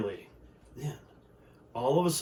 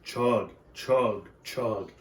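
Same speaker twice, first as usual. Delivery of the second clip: train chugging; 3 semitones lower, much slower than usual. Acted. Train sound effect in story.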